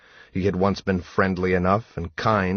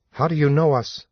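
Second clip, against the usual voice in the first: hard voice; 9 semitones higher, 3 dB louder, faster than usual. A narrator's reading. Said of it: "voice hard".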